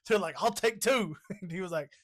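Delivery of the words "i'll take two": eager buyer. Acted. Acting out film's eager customers.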